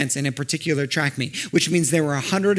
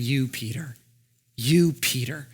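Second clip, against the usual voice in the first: soft and intimate, close to Peter; tender, personal; 3 semitones lower, 4 dB quieter, slower than usual. Acted. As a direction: gently say his name. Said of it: "it's deeply personal".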